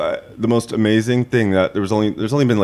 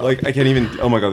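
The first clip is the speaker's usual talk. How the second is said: ordinary talk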